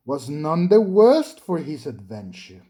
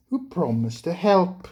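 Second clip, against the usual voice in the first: plain reading voice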